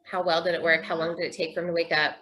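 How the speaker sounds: usual speaking voice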